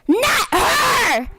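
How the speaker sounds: high shocked scream; screaming stepsister. A narrator's reading. Other stepsister screaming in outrage. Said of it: "screamed the other stepsister".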